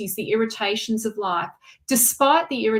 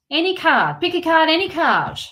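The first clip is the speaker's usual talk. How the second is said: higher, louder mock magician's patter; playful card-trick patter; 6 semitones higher, 5 dB louder than usual. Reader drawing a card for a silent viewer, mock magician patter.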